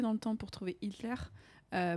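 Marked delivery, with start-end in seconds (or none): none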